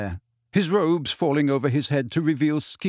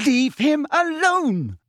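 high, drawn-out furious shout; 13 semitones higher, 4 dB louder, slower than usual. A narrator's reading. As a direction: shout slowly, demanding he stop. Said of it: "Lily shouted".

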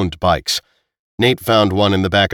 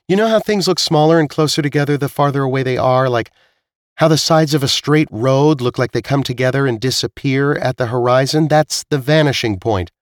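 higher-pitched, explanatory character voice; explaining Zila; 6 semitones higher than usual. Acted. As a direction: explain it patiently in a raised pitch.

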